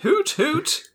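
calling hoots. Pretend. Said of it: "Calling out to them".

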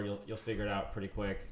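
ordinary talk, nothing marked

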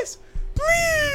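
very high squeaky plea; mock begging. Begging for sponsor.

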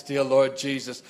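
ordinary talk

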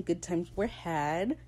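ordinary delivery

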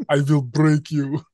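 slow, heavy Russian boxer voice; menacing movie villain threat, drawn out; Ivan Drago. Retold or imitated. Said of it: "Looks like Ivan Drago".

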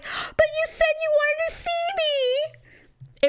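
very high, drawn-out mock wail; mock whining protest. Boyfriend protesting to her in an argument, mock whiny. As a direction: imitate his wounded complaint in a squeal. Retold argument, his reply.

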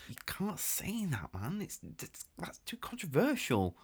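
urgent hushed whisper. Acted. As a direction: whisper it so nobody hears.

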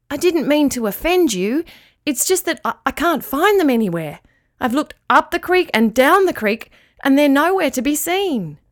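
plead, worried for lost friends; worried dog. Acted.

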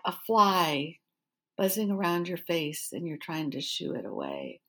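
retold incredulous repeat, dropping away at the end; deadpan disbelief. Echoes his excuse in disbelief.

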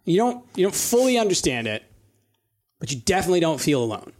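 usual speaking voice

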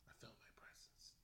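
hushed whisper, barely voiced. Real, not acted. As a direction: whisper it like a secret. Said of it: "Why are you whispering?".

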